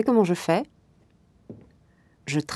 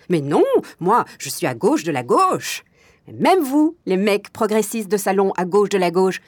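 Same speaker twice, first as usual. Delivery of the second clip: indignant tirade; 2 semitones higher, 4 dB louder than usual. Acted. Club owner defending her sex life to progressive men, indignant. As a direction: hammer it at your critics.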